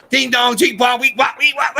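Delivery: high-pitched gibberish puppet chant; Peanut. Retold or imitated. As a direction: rattle off nonsense syllables very high. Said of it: "so he goes".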